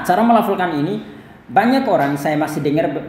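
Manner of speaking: normal talking voice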